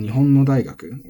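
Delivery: Nihon no daigaku with the pitch dropping after no; intended pitch. Shown as a demonstration. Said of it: "I'm saying".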